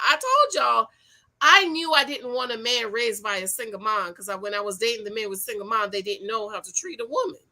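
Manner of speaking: normal talking voice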